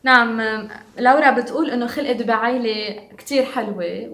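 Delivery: ordinary talk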